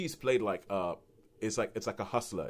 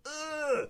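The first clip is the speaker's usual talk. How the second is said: disgusted groan. Retold or imitated. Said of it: "and you're like".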